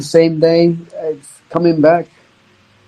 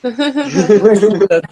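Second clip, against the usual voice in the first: laughing; 4 semitones higher than usual. Real, not acted. Laughing while teasing.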